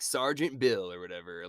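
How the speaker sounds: ordinary delivery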